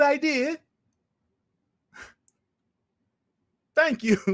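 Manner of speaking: high-pitched put-on British voice; fake British accent. Host clowning as a British schemer plotting to block the acquisition, fake British accent. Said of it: "you just heard my fake British accent".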